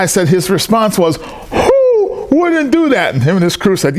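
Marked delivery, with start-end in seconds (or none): 1.2-3.1 imitating a very high, long-drawn cry of amazement; incredulous exclamation. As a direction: stretch each word slowly, pitched way up. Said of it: "That's how he talks".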